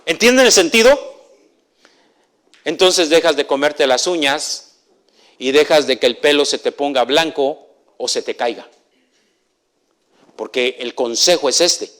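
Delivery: loud, high, emphatic sermon delivery; full-force pulpit preaching; fiery preaching. Acted. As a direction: preach it out to the whole congregation.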